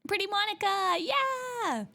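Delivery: high excited coo; delighted squeal. Real, not acted. Delighted at art.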